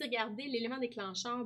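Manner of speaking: ordinary delivery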